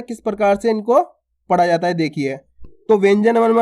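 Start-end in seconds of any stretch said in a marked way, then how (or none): none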